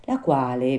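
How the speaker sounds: ordinary delivery, nothing marked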